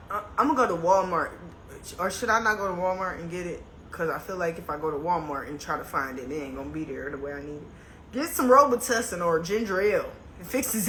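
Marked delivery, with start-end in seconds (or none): 2.3-7.6 trailing off quietly. Real, not acted. Mutters doubt about Walmart.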